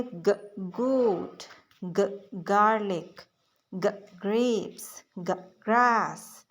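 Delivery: "ग" then each word said very slowly and clearly; slow phonics drill. Shown as a demonstration. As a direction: drag out each word for learners. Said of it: "ग वाली जो sound है, उसकी करना है".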